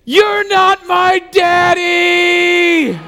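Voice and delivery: high, drawn-out shriek at full volume; toddler screaming. Retold or imitated. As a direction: imitate a tiny child wailing it out very slowly. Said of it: "She's screaming at the top of her lungs".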